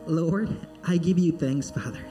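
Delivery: ordinary delivery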